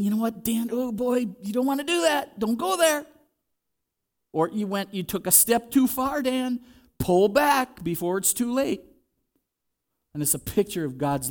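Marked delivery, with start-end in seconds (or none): 0.0-3.1 a tender whisper-like nudge, raised pitch; gentle inner warning. Acted. Holy Spirit warning Dan away from danger, high gentle caution. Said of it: "The Holy Spirit is saying". 4.3-8.8 gentle inner warning. Acted. Holy Spirit calling Dan back, high gentle pleading. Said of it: "The Holy Spirit is saying".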